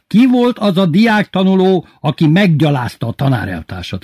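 high, loud indignant interrogation; enraged headmaster. A narrator's reading. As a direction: demand it, apoplectic with outrage. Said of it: "kérdezte az igazgató lila fejjel". (dühös, felháborodott igazgató)

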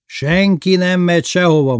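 high, loud, cheerful insistence. A narrator's reading. Lili keeps the guests from leaving, cheerful and loud. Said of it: "mondta derűsen Lili".

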